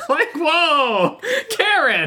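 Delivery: high-pitched, laughing mock shock. Co-host reacting to a child's imagined line, mock-shocked. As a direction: play scandalized, pitched well up.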